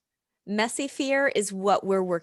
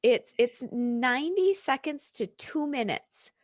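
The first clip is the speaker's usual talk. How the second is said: ordinary delivery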